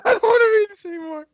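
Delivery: high, fast, wailing through laughter; laughing. Real, not acted. Reader pleading to friends to stop, laughing. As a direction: beg to stop while laughing.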